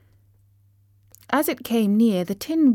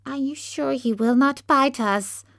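high, nervous little squeak; timid voice; 6 semitones higher than usual. Acted. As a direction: ask fearfully. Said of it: "asked in a timid voice".

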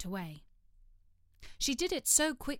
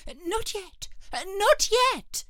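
high, loud hurried protest; hasty interruption; 11 semitones higher, 7 dB louder, much slower than usual. Acted. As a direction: jump in anxiously. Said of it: "the rabbit hastily interrupted".

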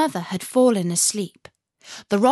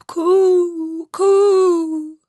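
high, loud cooing of pigeons; 10 semitones higher, 9 dB louder than usual. A narrator's reading. Wood pigeons call to Gerda with high, loud coos. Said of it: "said the wood pigeons".

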